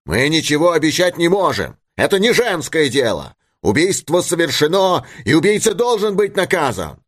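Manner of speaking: high, heated insistence; stern crowd verdict. A narrator's reading. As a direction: lay down the verdict with rising pitch. Crowd rejects her, demands punishment. (суровый, запальчивый ответ высоким голосом)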